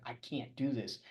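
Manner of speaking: a hushed retold "I can't"; despairing self-talk. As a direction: say it to yourself as if giving up. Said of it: "And I was like".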